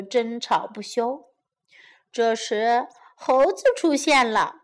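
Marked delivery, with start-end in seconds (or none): none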